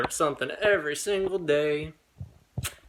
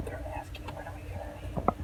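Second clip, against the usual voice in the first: fast, hushed whisper; 9 dB quieter, much faster than usual. Real, not acted. Telling viewers about the manager while hiding, fast whisper. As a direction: whisper quickly so the manager can't hear. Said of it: "I gotta be quiet".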